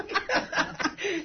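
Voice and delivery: high teary laugh; embarrassed giggle; real laugh. Real, not acted. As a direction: laugh through the tears. Laughing through tears.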